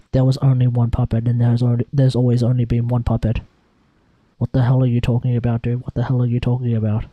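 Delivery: Paimon. Retold or imitated. Paimon berating the Traveler in a low loud voice. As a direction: drop the voice low and keep berating. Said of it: "Paimon would look at you and be like".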